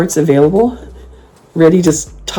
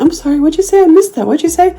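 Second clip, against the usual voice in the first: very high, mock-innocent voice; act all innocent; 13 semitones higher than usual. Shown as a demonstration. Asking a rude person to repeat it, all innocent. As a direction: pretend you honestly missed it, pitched way up. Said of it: "Just act all innocent".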